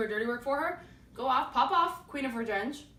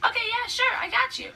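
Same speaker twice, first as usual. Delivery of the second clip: high, eager yes-man voice; suddenly much higher and overeager; a hammed-up eager helper; eager Aegeus; 14 semitones higher, 6 dB louder than usual. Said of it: "And Aegeus is like".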